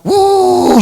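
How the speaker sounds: high, drawn-out "uuuh" whoosh; imitated dragging noise. Preacher imitating what scraped across the roof, high whoosh. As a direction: voice the sound of something dragged along. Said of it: "algo que se arrastró".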